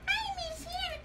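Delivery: high, soft squeaky whine; Mickey Mouse. Pretend. Mickey toy missing someone, high pretend crying voice. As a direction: whimper it as a tiny pretend toy. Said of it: "Mickey Mouse go".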